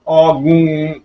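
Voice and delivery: strangled grunt; acted choking groan. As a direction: gurgle as if gripped by the throat. Villain being choked by the neck. (गला दबने पर घुटी कराह)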